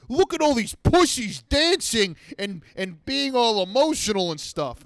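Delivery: high, sneering mock voice of his own body; mocking inner voice. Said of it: "my body was just like".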